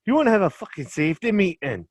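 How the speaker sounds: loud, high mock Scottish insult; rough up a mate in a hammed-up Scottish accent. Host ribs his cousin in a loud mock Scottish accent. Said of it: "Maybe start with a bit of Scottish accent".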